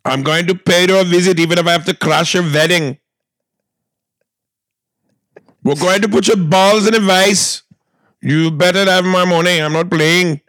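put-on loud, high Indian gangster accent; mock Bollywood gangster. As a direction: threaten like a Bollywood goon. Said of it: "those gangsters".

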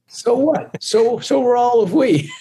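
high, teasing Scottish audience retort; mock Scottish accent. Singer mimicking Scottish crowd shrugging off his birthplace, mock Scottish accent. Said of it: "They're like".

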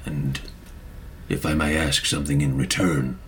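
low, measured character voice with long pauses; solemn Maka. Acted. Maka asking Lord Fievel a favor, low and solemn. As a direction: speak low and unhurried, with quiet courtesy.

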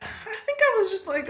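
light laugh running through the words; giggling while admitting she missed it. Real, not acted. Co-host laughs as she admits missing it, giggling.

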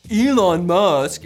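"Elon Musk" said very slowly and loudly, each syllable stretched; drawn-out name. Shown as a demonstration. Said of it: "is how I say his name now".

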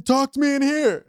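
put-on smug drunk college guy; drunk frat boy. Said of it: "you do the drunk frat boy so good".

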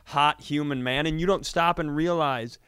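ordinary talk

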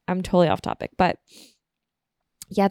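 ordinary talk, nothing marked

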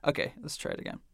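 soft unvoiced hush; whispering. Real, not acted. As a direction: whisper it before the replay. Hushed replay of ghost clip.

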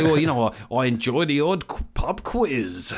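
put-on ironic, jocular voice; mock an awkwardly ironic middle-aged man; Alan Partridge. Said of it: "they speak like Alan Partridge".

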